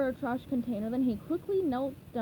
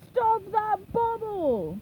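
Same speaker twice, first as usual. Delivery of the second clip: higher, very slow drawn-out order; command shout; 9 semitones higher, 4 dB louder, much slower than usual. A narrator's reading. Mr. Krabs orders the mob after the bubble, slow loud command. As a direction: yell it to the chasing mob.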